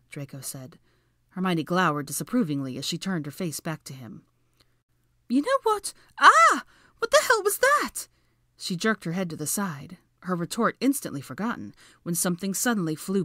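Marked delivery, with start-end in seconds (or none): 5.3-8.1 high, loud yelp of alarm; startled shriek. Acted. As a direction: cry out in sudden fright, voice shooting up. Startled by something flying past.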